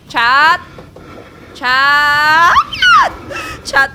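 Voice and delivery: loud panicked call to chat; alarmed shout. Real, not acted. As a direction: cry out to the viewers for help.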